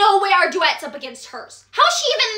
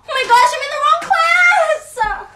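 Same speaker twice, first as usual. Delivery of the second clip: higher, crying-toned cry of panic; panicked shout; 7 semitones higher than usual. Acted. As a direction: panic about being late and lost. Freshman realizes wrong classroom.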